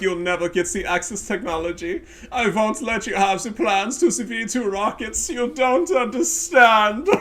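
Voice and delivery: high, loud, slow mocking voice; mocking whiny opponent. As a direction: mimic the salty opponent, way up high. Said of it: "He's like".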